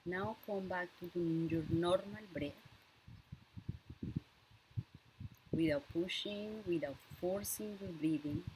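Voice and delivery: unhurried breathing cues, leaving silence for each breath; guided breathing meditation. Real, not acted. Leads a breathing exercise.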